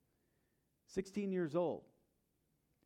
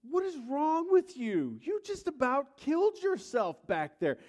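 high, loud, slow furious outburst; frenzied yelling; 12 semitones higher, 8 dB louder than usual. Retold or imitated. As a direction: let it all out at the young driver. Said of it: "I'm, like, in this frenzy".

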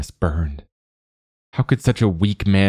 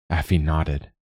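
ordinary delivery

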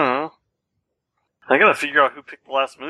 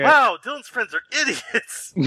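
slow, higher mom voice; mock the mom's judgment; imitating mom; 9 semitones higher, slower than usual. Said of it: "his mom's just gonna think".

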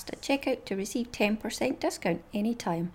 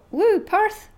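high, loud little cheer; excited woo; 10 semitones higher, 7 dB louder than usual. Real, not acted. Podcaster cheering about the Perth trip. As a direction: cheer the name like a fan.

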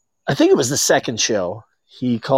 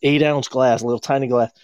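ordinary delivery

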